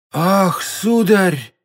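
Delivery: high-pitched frightened servant; fearful, plaintive exclamation; scared muttering. A narrator's reading. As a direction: moan it to your master, half-dead with fear. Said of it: "еле живой от страха".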